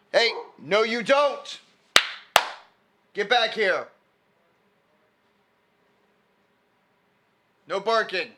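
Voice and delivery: loud, high scolding shout; yelling at the dog. Real, not acted. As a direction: bark the orders so the dog stops. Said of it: "I'm yelling at a dog".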